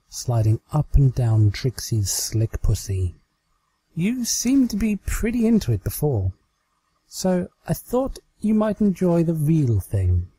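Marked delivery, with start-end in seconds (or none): 3.9-10.3 higher, coy mare voice; playful offer to her captive; Twilight, teasing. Acted. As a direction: tease her with a knowing lilt.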